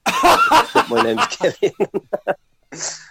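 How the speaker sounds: high, helpless laughter through the words; laughing. Real, not acted. Storyteller amused by his own classroom prank, laughing through the words. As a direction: crack up while saying it.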